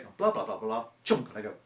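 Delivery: ordinary talk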